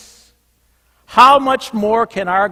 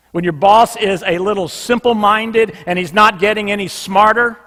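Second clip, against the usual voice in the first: normal talking voice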